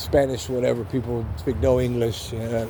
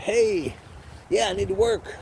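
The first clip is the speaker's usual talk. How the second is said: higher-pitched, confident greeting; 7 semitones higher than usual. Retold or imitated. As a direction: retell walking in sure of yourself. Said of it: "I'm like, I'm confident".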